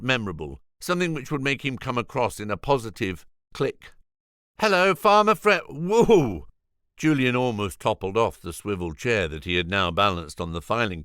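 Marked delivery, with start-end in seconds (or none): 4.6-6.5 much higher, louder eager whoop; a dragon gushing at his hero; overexcited greeting. Acted. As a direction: burst out with nervous over-eagerness. George rehearses an eager greeting.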